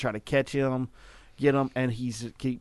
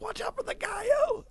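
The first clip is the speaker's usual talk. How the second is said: very high-pitched mock yell; called-out warning; 5 dB quieter than usual. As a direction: re-enact a panicked heads-up, voice leaping way up. Re-enacts warning man crossing street.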